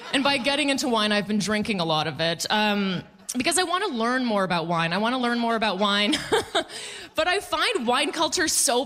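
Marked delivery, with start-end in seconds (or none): none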